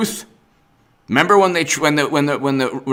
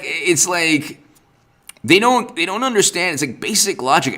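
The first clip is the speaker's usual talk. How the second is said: usual speaking voice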